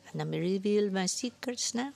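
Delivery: put-on old woman's teasing French accent; coy old woman with a French accent. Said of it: "heavy French accent".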